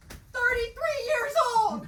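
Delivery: frantic cry. Acted. Panicked rant about dying young.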